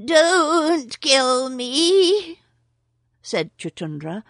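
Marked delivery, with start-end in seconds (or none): 0.1-2.4 high, slow, pleading whimper; almost weeping. Acted. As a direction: beg for your life, close to tears. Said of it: "almost weeping".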